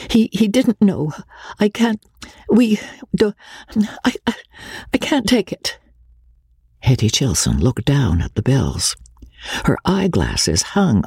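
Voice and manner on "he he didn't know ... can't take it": high, halting, breaking off; young wife pleading, flustered; flustered stammer. Acted. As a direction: stumble over the refusal. Magnolia stammers refusal.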